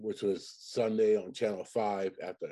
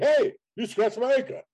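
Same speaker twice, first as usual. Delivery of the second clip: high-pitched indignant movie-character holler; raised, put-on voice of an affronted boater; Rodney Dangerfield; 11 semitones higher, 4 dB louder than usual. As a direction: call out the complaint in a comic impression. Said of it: "the part where Rodney Dangerfield comes up".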